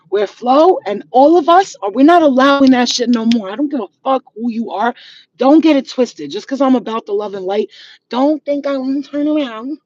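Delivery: usual speaking voice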